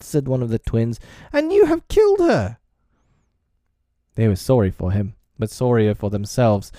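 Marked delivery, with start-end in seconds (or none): 1.3-2.6 high, loud outraged cry; anguished accusation. A narrator's reading. Twin blaming Tootles for killing her, loud accusation. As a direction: hurl the blame, high and loud.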